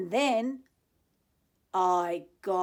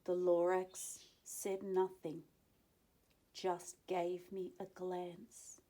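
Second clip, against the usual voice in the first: story narration; 3 semitones higher than usual. A narrator's reading. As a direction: read it gently to children. Reading the story to children.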